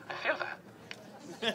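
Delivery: a soft, mock-moved whisper; touched whisper. Imitating a stranger in the crowd moved by the bullhorn, whispered. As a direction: breathe it out like you're deeply touched.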